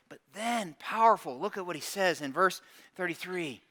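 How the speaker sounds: ordinary talk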